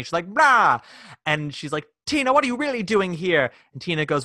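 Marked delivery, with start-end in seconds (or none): 0.3-0.8 a loud high diva exclamation; mock theatrical blah. Diva bursting out at Tina, loud stagey blah. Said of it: "she starts even talking differently". 2.1-3.5 higher put-on grande dame voice; imitating diva Judy. Judy questioning Tina, put-on diva voice. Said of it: "she starts even talking differently".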